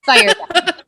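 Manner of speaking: loud, high, forceful order; dead serious. Real, not acted. Advising friend about overdemanding people, dead serious. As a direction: fire it out loud and in earnest. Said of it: "She's so serious about it".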